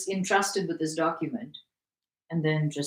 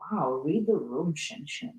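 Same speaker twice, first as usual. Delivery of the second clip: usual speaking voice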